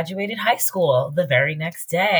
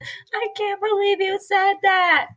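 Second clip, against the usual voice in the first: much higher, slow and appalled; high scandalized voice; slower than usual. Retold or imitated. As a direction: gasp it in falsetto. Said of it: "the other ones were like".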